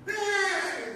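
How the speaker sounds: one high cackling "Eh"; sharp high mock demon cackle; demonic cackle. Shown as a demonstration. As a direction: yelp it like the possessed. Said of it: "gitu, Saudara".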